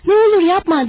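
high, loud desperate begging; frightened plea; pleading. Acted. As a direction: beg him in panic. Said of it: "diye yalvardı sonra".

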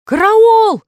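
пронзительный высокий крик «Караул!»; заорать в испуге, высоко и громко. A narrator's reading. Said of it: "крикнул кровожадный вампир". (испуганный крик вампира)

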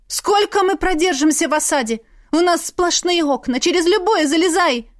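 high, agitated mother arguing; anxious protest. A narrator's reading. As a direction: push back in rising alarm. Mother argues in alarm with father. (тревожно, на повышенных тонах)

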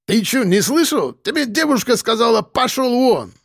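high-pitched confrontational demand; indignant challenge. Acted. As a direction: hammer it home, high and heated. Rival confronts the hero. (возмущённо, с вызовом)

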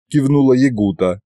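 usual speaking voice

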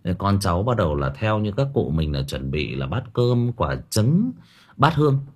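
ordinary talk, nothing marked

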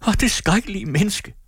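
high-pitched exclamation; indignant cry. A narrator's reading. Amalie comforts her sister after the scene, high-pitched cry. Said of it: "råbte Amalie".

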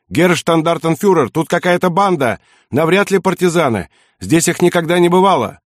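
high, urgent shouted report; alarmed shout. A narrator's reading. Motorcycle guard reporting an ambush to his commander, alarmed shout. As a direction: call it out in alarm. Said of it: "кричит".

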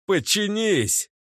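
high, slow commanding shout. A narrator's reading. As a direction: bellow the order. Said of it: "заорал я вслух".

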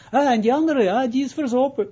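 high, loud, amused comedian's line; laughing punchline. Retold or imitated. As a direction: deliver the joke's twist with a laugh. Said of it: "Hij zegt".

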